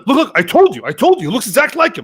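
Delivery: high-pitched retold I-told-you-so; imitating excited stranger. Voices stranger who ran up excitedly.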